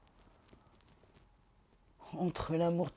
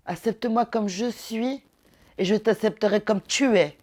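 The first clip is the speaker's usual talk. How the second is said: higher, louder insistent plea; Célimène; 4 semitones higher, 7 dB louder than usual. Acted. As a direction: press the bargain home as the play's heroine. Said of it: "ce que Célimène veut faire comprendre".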